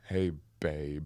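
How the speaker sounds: low, soft sleazy Hey, babe. Shown as a demonstration. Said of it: "It sounds awful".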